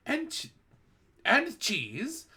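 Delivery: high, loud screeching shout; Grand High Witch shouting orders; witch's shout. A narrator's reading. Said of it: "shouted the Grand High Witch".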